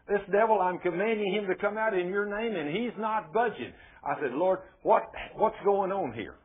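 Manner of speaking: high-pitched, mildly exasperated complaint; baffled plea. Acted. Preacher asking God why the devil won't budge, baffled plea. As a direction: appeal upward, a touch frustrated.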